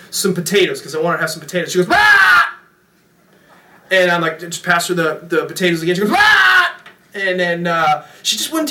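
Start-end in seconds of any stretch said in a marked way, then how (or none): 1.9-2.6 loud high imitated baby shriek; baby squawk. Baby protests the potatoes. 6.1-6.8 loud high imitated baby shriek; baby squawk. Baby protests potatoes second time.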